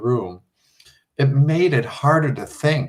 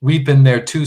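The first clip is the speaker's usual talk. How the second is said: snapping; 2 semitones higher, 7 dB louder than usual. Acted. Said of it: "snapped Jill".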